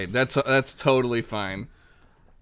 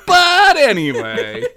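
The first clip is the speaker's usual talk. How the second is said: laughing; 13 semitones higher, 10 dB louder, slower than usual. Real, not acted. Recovering from laughter.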